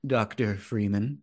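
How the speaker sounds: slow, low G-Man drawl; lightly voiced eerie entity, slow; talking mask. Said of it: "my Man in the Sun Mask voice is just directly lifted loosely".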